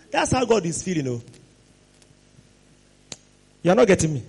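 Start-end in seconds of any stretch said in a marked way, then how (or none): none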